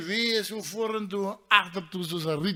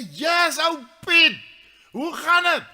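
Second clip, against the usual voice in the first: high, loud hail; calling out; 9 semitones higher, 7 dB louder, slower than usual. Acted. Storyteller greets his friend high in the stand, calling out. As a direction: call up to someone far off.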